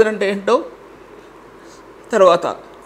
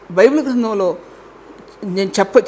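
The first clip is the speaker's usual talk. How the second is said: ordinary delivery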